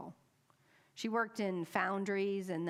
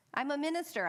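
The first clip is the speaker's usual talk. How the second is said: bright reply; 8 semitones higher, faster than usual. Retold or imitated. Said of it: "I say".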